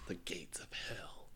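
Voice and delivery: whispered ominous words; spooky whisper. Pretend. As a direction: whisper it like a ghost story. Spooky Russia hole.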